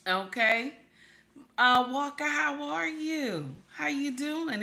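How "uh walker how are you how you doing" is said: high, slow, sing-song hello to a viewer; high sing-song welcome to a viewer named Walker; sing-song greeting. Real, not acted. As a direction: greet a friend brightly, drawing out how are you.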